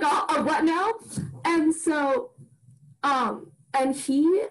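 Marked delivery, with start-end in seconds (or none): 0.0-0.9 high-pitched, stammered mock confusion; amused disbelief, pitched well up; baffled. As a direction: play up not following at all. Retelling her confused reaction.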